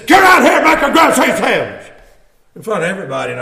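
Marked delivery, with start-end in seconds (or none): 0.1-1.9 shouted order. Retold or imitated. Cursing owner barks an order.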